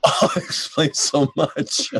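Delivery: slow, laughing "it explains so much"; laughing mock epiphany; laughing realization. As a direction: say it through a laugh, amazed. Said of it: "I was like".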